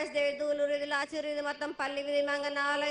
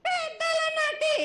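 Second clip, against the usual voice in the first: drawn-out cry; 12 semitones higher, 5 dB louder, much slower than usual. Acted. Goddess cries out her names.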